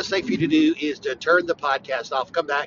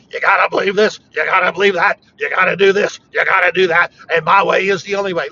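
mock preachy lecture, a little louder; mocking theologians; 6 dB louder than usual. Preacher mocking theologians who insist their way is the only way. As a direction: parody a know-it-all theologian laying down rules. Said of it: "all those theologians out there always spouting off".

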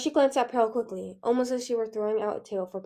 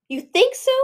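high, loud, hurt question; hurt, incredulous outcry; wounded cry; 10 semitones higher, 10 dB louder than usual. A narrator's reading. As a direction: cry out, stung by the doubt. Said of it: "Pearl cried, wounded".

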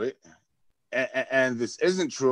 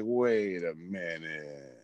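low, very slow and quieter, a dawning doubt; slow realization; 7 semitones lower, 7 dB quieter, much slower than usual. Retold or imitated. Reacting to the fake accent video, lightly acted slow wait. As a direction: draw it out as it sinks in. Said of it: "And I'm like".

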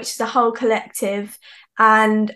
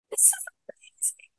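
imitated breathless whisper; awed whisper; 20 dB quieter than usual. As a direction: whisper it, as if overwhelmed by the singing. Imitates an awed girl's reaction.

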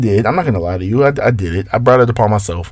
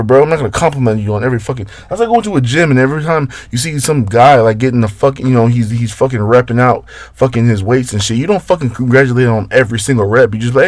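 normal talking voice

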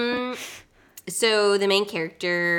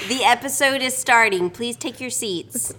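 lightly voiced formal announcement; town crier; 5 semitones higher, 7 dB louder than usual. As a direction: proclaim to the audience. Mock announcer continues.

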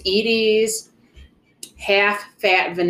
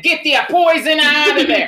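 imitating dad; 8 semitones higher, 6 dB louder than usual. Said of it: "my convo that you just heard with my dad".

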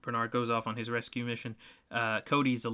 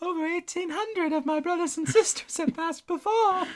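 very high sustained falsetto; Muppet ghost; 4 dB louder than usual. Acted. Co-host doing the movie ghost, in high falsetto. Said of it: "the right voice, but the wrong line".

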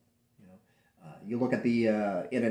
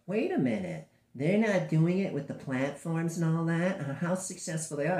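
mock curious outsider; 8 semitones higher, 4 dB louder than usual. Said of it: "and it's like".

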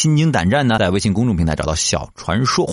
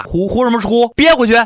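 high, loud barked order; dad shouting at the kid to hold back tears; angry dad; 13 semitones higher, 4 dB louder than usual. Retold or imitated. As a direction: bark it up high at the sniffling kid. Dad ordering child stop crying.

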